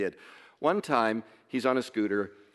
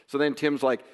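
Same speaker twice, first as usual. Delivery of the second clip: ordinary talk, nothing marked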